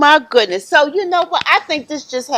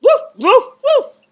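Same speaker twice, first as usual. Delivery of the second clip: loud, high-pitched mock woofs; big dog bark; 11 dB louder than usual. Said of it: "let me hear your big dog sounds".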